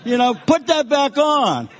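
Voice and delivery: loud, high mock protest; joining the yelling. Blending in with angry inmates, loud mock yell. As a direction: holler along with the crowd to blend in. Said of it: "I'm saying the same thing".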